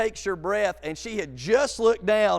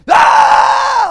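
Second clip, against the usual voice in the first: high, very loud long shriek; imitated scream; 17 dB louder than usual. Girl plunging down the drop, screaming. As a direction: shriek it at full volume. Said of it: "like this".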